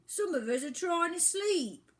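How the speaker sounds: high, loud sleepy complaint; grumpy bird. Acted. Woken bird scolding the boy, high grumpy voice. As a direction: scold him for the noise.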